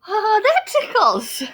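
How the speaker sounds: high, loud, slow giggly voice; tickled. Acted. Larry's tummy is scratched, high and tickled. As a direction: squirm as if tickled.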